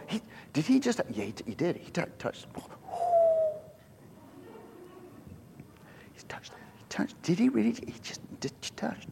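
hushed, halting whisper of disbelief; shocked whisper. Acted. Preacher acting the crowd seeing the coffin touched, shocked whisper. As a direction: whisper it like a scandalised onlooker.

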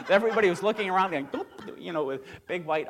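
fast, laughing retelling; can't hold back the laugh at the memory; laughing while telling. Real, not acted. Laughing at blackened faces memory.

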